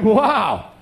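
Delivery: high, drawn-out mock self-admiration; carried away. Preacher impressed by his own sharing, comically carried away. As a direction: marvel slowly at yourself, hammed up. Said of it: "you kinda get carried away".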